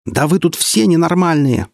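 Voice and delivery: high, slow indignant yell; outraged head. Acted. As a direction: strain red-faced with outrage. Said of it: "продолжила разбрасываться голова".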